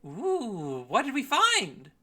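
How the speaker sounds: high, loud swooping voice; excited curious character. Acted. Game master as nosy character asking about chests, high and excited. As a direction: pipe up high, eager to see the find.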